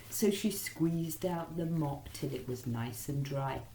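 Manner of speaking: ordinary delivery, nothing marked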